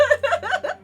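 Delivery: high excited giggle. Real, not acted. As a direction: burst into a giggle. Laughing at luck.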